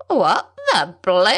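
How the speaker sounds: a lightly voiced old bushman, thin and startled; old pilot caught off guard, mildly put on; a hint of a reedy old Aussie pilot; thin high old man. A narrator's reading. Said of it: "The voice was thin and high-pitched".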